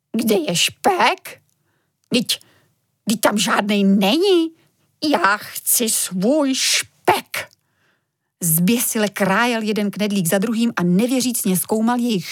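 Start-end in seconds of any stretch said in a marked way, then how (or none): 0.1-7.5 slow, drawn-out dismayed wail of a father; dismayed lament. Acted. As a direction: stretch it out like a man robbed of his treat. Father finds no bacon, dismayed. (pomalý, zoufalý nářek)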